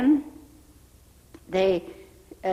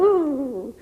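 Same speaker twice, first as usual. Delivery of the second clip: high, louder shivering ooh; cold gasp ooh; 6 semitones higher, 6 dB louder than usual. Retold or imitated. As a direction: squeal at the icy clothes. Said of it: "So I'd, I'd go".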